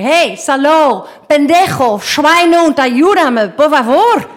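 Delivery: high, loud and slow furious insults; angry shouting. Retold or imitated. Angry survivor yelling insults at the stranger who won't help. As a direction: hurl the insults at him in rage. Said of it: "now I'm getting angry".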